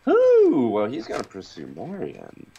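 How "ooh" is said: loud, high drawn-out "ooh"; teasing "ooh". Real, not acted. Actor asked what to expect from his character, teasing high "ooh". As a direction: let out a high whooping "ooh". Teasing reaction to plot question.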